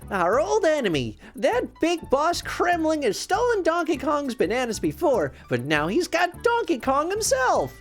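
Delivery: high-pitched indignant outburst; snarled. A narrator's reading. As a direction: rail against an old enemy. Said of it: "he snarled".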